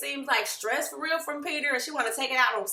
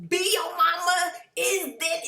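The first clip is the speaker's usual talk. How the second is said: higher, drawn-out mock insult; not joking, insulting; 7 semitones higher than usual. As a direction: make the B a real dig. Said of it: "not like".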